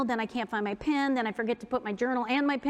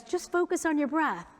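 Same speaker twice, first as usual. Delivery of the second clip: higher, singsong mock advice; mocking the helpful advice; 7 semitones higher than usual. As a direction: parrot the annoying advice. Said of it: "someone says".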